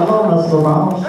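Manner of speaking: high-pitched character greeting; formal hello in a goofy high voice; silly chicken voice. Retold or imitated. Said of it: "I would use that voice".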